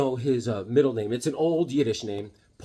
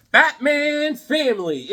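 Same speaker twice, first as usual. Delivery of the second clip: slow, high, stretched title call; announcer; 11 semitones higher, 4 dB louder, slower than usual. Pretend. Host revealing his next comic find, stretched announcer call. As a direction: trumpet the title grandly.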